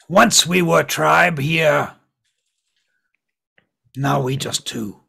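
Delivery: slow, loud goblin lament; heavy, drawn-out goblin voice, dropping at the end; mournful goblin. Acted. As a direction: lament the lost tribe as a goblin. Goblin mourns its lost tribe.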